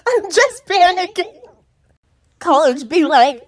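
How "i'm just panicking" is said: panicky student. Retold or imitated. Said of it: "he says".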